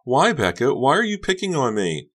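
high, loud, hurt wail; sobbing plea. A narrator's reading. Honey in tears to Becca, wailed plea. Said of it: "Honey uttered a loud sob".

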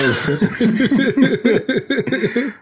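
a high burst of real laughter. Real, not acted. Laughing at his cohost's joke about the tangent, high real laughter. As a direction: crack up at the joke.

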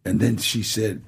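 ordinary talk, nothing marked

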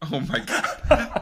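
high, slow, laughing exclamation; laughing oh my God. Real, not acted. Host laughing at his cohost's Delco joke, laughing. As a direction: crack up at the joke.

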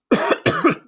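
one sharp cough; sudden cough. Real, not acted. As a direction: cough out loud. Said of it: "Excuse me".

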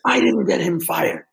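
high, drawn-out mock yell; exclaimed denial, imitating the actor; retold outburst. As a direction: blurt it out as a loud, strained retold protest. Retold indignant denial from star.

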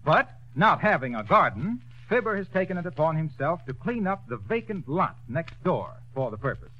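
ordinary talk, nothing marked

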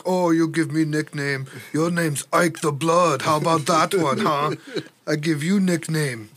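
hammed-up foreigner with an Austrian accent. Gangster handing back a nickname, in a mock Austrian accent. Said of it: "giving him this accent".

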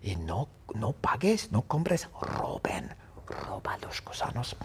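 soft unvoiced whisper. Hayato tells friends to steal the worms, whispering. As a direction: breathe it as a sneaky order. Urging theft in secret.

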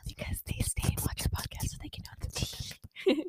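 whispered aside to the fans; conspiratorial whisper to listeners; secret whisper. Real, not acted. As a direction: share the gossip in a hush. Spilling secret tea to fans.

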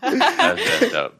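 high, slow, laughing repeat; laughing disbelief. Real, not acted. Laughing in disbelief at a friend's joke, slow and high. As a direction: drag it out through a laugh.

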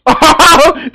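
high, loud burst of laughing; burst of laughter. Real, not acted. Streamer reacting to the Karen clip, loud laughter.

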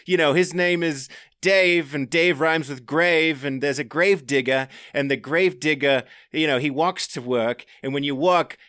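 breezy Aussie mate explaining nicknames; imitating Aussie riders. Recalling Aussie riders explaining a nickname, light Australian imitation. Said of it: "And it was like".

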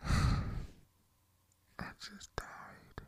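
extremely slow, breathy words; sigh, then let it out slowly in defeat; drawn-out, breathy despair. Acted. Despairing aside in nightmare monologue.

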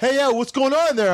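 high-pitched surfer dude impression; surfer kid. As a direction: imitate a nosy surfer kid calling in. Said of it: "that surfer kid that was always".

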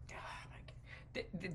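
ordinary talk

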